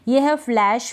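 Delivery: normal talking voice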